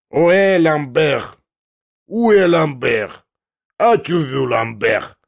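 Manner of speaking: high, loud, drawn-out bellow; roared call. Acted. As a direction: roar it as a parting call. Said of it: "рявкнул он в последний раз".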